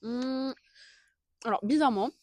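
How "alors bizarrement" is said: normal talking voice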